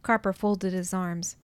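usual speaking voice

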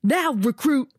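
high barked yell. Acted. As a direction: yell it right in his ear. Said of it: "yelling in my ear".

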